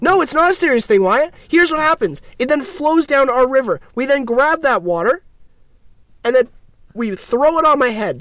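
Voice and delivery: high-pitched, insistent rant. Pretend. Host explaining shower water to doubting friend, ranting. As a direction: argue it worked up and high. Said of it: "Here comes a rant".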